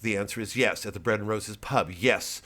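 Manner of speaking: ordinary talk, nothing marked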